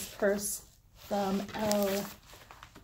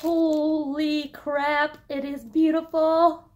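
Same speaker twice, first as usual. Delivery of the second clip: higher, louder, very slow gush; thrilled outburst; 6 semitones higher, 8 dB louder, slower than usual. Real, not acted. Vlogger gushing to viewers as the bag comes out, thrilled. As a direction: stretch it out in pure delight.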